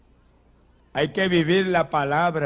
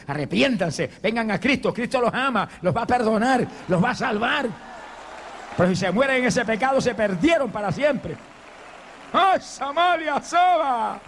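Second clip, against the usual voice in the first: impassioned preaching; 7 semitones higher than usual. Real, not acted. Preacher calls sinners to Christ, impassioned and rising. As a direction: preach urgently, building to a high wail. Preacher rises into an altar appeal.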